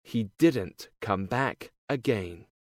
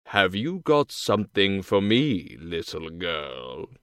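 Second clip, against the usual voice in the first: wolf voice; 5 semitones lower than usual. A narrator's reading. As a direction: play the big bad wolf, low. Wolf's voice.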